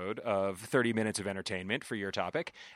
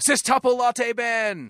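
hype shout; 11 semitones higher, 9 dB louder, slower than usual. Acted. Announcing nickname loudly.

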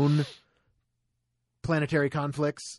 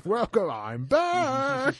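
movie quote voice; 12 semitones higher, much slower than usual. Pretend.

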